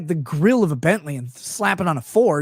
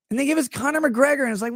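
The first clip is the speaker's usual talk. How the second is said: normal talking voice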